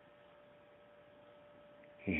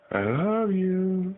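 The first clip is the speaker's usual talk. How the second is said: high slow coo; 10 semitones higher, slower than usual. Shown as a demonstration.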